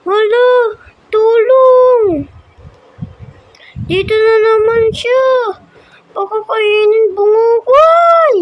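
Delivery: high, loud panicked cries; screaming for help. Real, not acted. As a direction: shriek in real fright. Chased again, cries for help.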